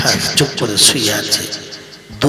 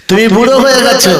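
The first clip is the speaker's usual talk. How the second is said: তুমি বুড়ো হয়ে গেছো cried out much higher and louder, stress on হয়ে; pleading cry; 9 semitones higher, 8 dB louder, faster than usual. Acted. Daughter cries out to her mother, high loud plea. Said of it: "repeated louder".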